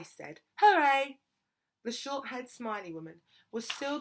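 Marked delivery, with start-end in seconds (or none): 0.6-1.2 loud, high exclamation; cheering. A narrator's reading. Girl's reply on what Jesus would say, loud cheering. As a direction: burst out with a cheer.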